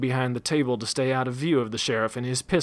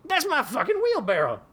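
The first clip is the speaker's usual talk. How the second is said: outraged shriek. A narrator's reading. Daggett enraged.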